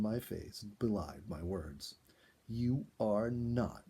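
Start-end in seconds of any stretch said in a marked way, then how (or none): 2.5-3.9 slow, drawn-out protest; remonstrating. A narrator's reading. Ginny scolding Sam for his fake apology, slow and reproving. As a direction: stretch it out, scolding. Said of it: "Ginny remonstrated".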